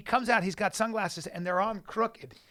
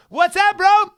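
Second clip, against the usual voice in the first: much higher, louder challenge; actor playing a cop; 15 dB louder, slower than usual. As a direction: bark it like a wired TV cop. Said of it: "he goes".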